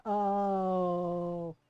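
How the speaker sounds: long, low drawn-out oh; ominous oh. Retold or imitated. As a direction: let the dread sink in, low. Dread at the cousin's boast.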